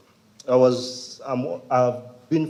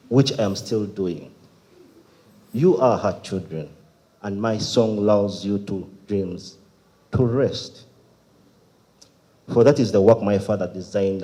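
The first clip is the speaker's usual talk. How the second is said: deliberate spoken-word reading; emphatic starts, falling line ends; poem recitation; 7 dB louder than usual. Acted. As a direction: recite phrase by phrase with pauses.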